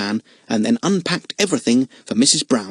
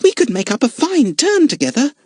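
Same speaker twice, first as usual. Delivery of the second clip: eager begging; 14 semitones higher, 4 dB louder than usual. Acted. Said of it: "begged Lotta".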